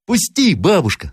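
put-on high, piping child's plea; pleading boy. A narrator's reading. Grandson asks grandmother to admit travellers, pleading. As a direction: beg like an eager little boy.

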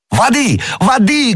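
very high, frantic cries; desperate shout. A narrator's reading. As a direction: scream for water. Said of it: "кричал Д'Артаньян". (отчаянный крик)

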